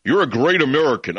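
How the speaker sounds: mock-admiring sneer; host mocks fawning callers sarcastically; sarcastic mockery. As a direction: sneer out the fake praise in a put-on voice. Host mocks critics' flattery.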